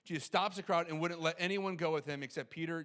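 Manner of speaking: usual speaking voice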